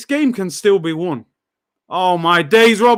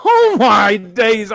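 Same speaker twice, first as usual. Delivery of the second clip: slow, loud, laughing exclamation; drawn-out laughing cry; laughing disbelief; 2 semitones higher, 6 dB louder, much slower than usual. Real, not acted. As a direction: laugh it out in despair. Laughing at a bad clearance.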